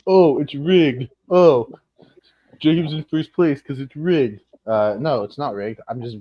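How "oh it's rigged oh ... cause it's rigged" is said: mock whiny fans. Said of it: "people are gonna be like".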